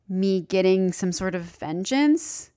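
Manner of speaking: ordinary talk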